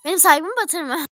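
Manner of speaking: yüksek, yüksek sesle azar; kardeşine bağırarak çıkış; sert çıkışma. Real, not acted. (yüksek sesle sert azar)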